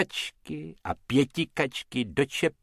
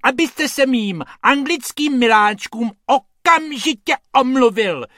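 a lady shrieking at the man who insulted her dogs; shrill indignant lady; 13 semitones higher, 9 dB louder than usual. A narrator's reading. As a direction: demand an apology outraged. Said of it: "ječí ta dáma silným hlasem".

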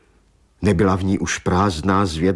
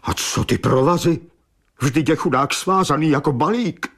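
agitated outburst, pitched higher; Maggie; 5 semitones higher than usual. A narrator's reading. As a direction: burst out, unable to hold back. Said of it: "To se znovu neudržela slečna Maggie". (vyhrknout rozčileně)

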